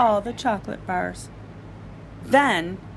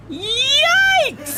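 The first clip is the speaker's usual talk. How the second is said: much higher, louder squeak of alarm; startled yikes; 5 dB louder than usual. Acted. Father sees three hundred chocolate bars, shocked squeak. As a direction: jump back in shock.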